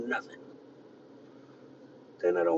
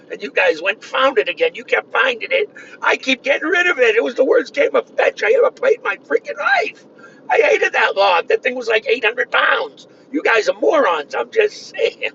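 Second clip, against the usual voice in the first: mounting indignant rant; 9 semitones higher, 7 dB louder than usual. Real, not acted. Ribbing the cop about the log, mounting outrage. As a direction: pitch climbing higher and louder toward a loud peak.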